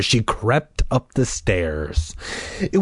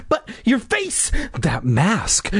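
shaken little-brother protest; stammering; 7 semitones higher, slower than usual. Acted. Brother staring at the mask, stammering. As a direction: stammer it, starting high. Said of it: "Noah stammered".

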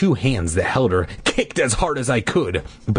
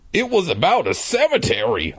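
explaining; 8 semitones higher than usual. Acted. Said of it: "I explained".

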